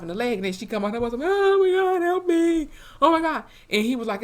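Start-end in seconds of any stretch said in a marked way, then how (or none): 1.2-3.4 high, loud fake cry for help; mock panic. Margaret faking fear to the cop, high and loud mock panic. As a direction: play the helpless victim, hammed up. Margaret fakes being a victim.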